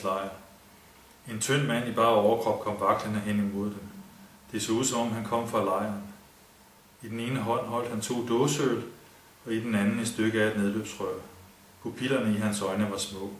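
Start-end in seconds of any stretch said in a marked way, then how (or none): none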